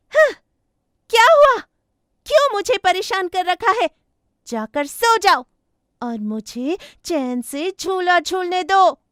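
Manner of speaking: high, loud, irritated ghost voice; ghost yelling. Acted. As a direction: yell at him to back off. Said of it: "चिल्लाने लगती है".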